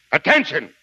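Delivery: command. Acted. Sergeant commanding.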